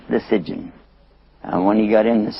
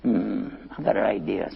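quiet, lower scheming aside; softly hatch a sly plan; low, soft and crafty; sneaky; 4 semitones lower, 10 dB quieter than usual. Acted. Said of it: "she's pretty sneaky".

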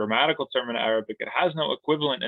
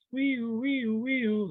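high, playful siren noise; police siren; 13 semitones higher, much slower than usual. Pretend. Mock police siren in jail metaphor.

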